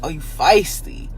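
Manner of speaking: drawn-out playful tease; mock the flirt slowly; slow amused "Oh, you feisty"; slow teasing. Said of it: "So he's like".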